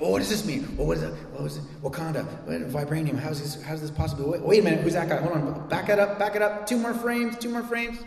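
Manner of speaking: rewind-it-now nerd panic, building; mock frantic fan. Pastor imitating fans freezing a trailer frame by frame, frantic.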